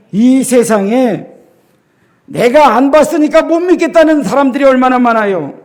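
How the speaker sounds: normal talking voice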